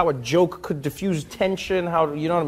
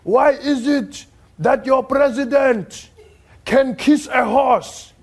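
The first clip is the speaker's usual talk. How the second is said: much higher, loud and very slow, milking the punchline; mock polite grandfather; 10 semitones higher, 4 dB louder, much slower than usual. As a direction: deliver the setup slowly with fake respect. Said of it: "he says".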